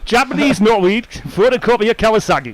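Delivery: put-on words in a light Geordie accent. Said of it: "he's going for the accents".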